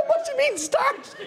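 high, laughing cry of disbelief. Retold or imitated. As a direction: squeak it out in horror. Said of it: "I go".

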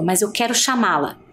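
usual speaking voice

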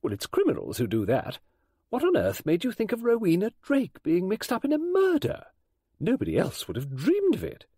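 an excited, nosy lady friend; brisk Mrs Oliver. Acted.

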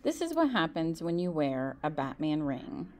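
ordinary delivery, nothing marked

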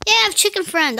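high, loud happy exclaim; delighted. Real, not acted. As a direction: cheer about the chicken friend. Delighted about chicken.

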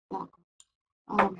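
usual speaking voice

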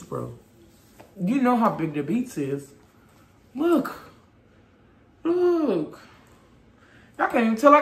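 speaking through thick fabric; through a knit mask. Real, not acted. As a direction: mouth covered by balaclava. Seen 2: Fuzzy balaclava covers mouth and nose throughout; hands adjust it at neck.

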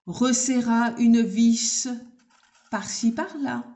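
plain reading voice

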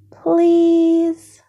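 put-on begging child. Child begs dad for kitten.

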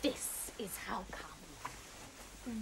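put-on villain whispering the big reveal to the boy. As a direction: breathe it out as a dramatic secret.

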